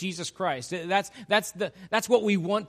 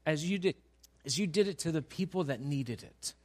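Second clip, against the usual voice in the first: ordinary delivery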